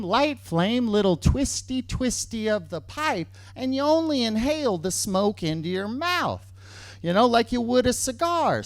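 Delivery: ordinary delivery, nothing marked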